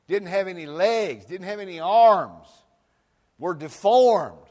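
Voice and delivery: loud, high, ringing preacher voice; preaching at full force. Acted. Pastor stirring up the congregation, loud and high. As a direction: preach it big, building.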